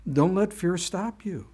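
ordinary delivery

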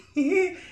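short high chuckle; a quick amused laugh; light laugh. Real, not acted. Reader laughs at listeners.

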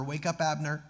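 ordinary talk

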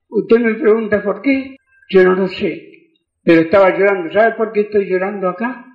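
normal talking voice

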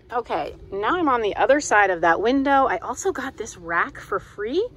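ordinary talk

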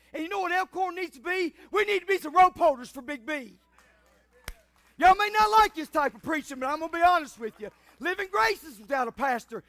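high, loud pulpit delivery; forceful preaching. Real, not acted. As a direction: drive each phrase home like a revival preacher.

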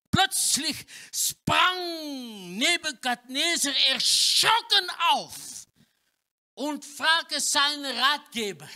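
dramatic telling. A narrator's reading. Preacher tells the king leaping up, dramatic telling. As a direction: tell it like a startling scene.

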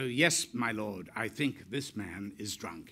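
low, soft dutiful reply; subdued obedience. Retold or imitated. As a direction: answer your lord meekly, voice dropped. Subordinate answering the emperor, retold.

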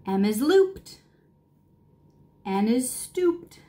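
ordinary delivery, nothing marked

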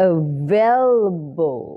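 "available" very slow, schwa in the weak syllables; schwa pronunciation. Shown as a demonstration. Teacher pointing out the schwa, slow drawn-out pronunciation. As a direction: stretch "available", keeping its first a a schwa. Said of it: "ชวา ชวาด้วย".

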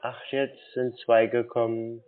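ordinary delivery

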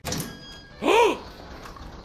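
high startled gasp; sharp gasp. Real, not acted. Gamer jump-scared in horror game, sharp gasp. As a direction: gasp in fright.

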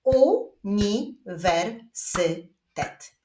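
very slow, five separate syllables; long word split with clear pauses. Shown as a demonstration. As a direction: clap out each syllable. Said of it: "a my wyklaskujemy sylaby w wyrazie uniwersytet".

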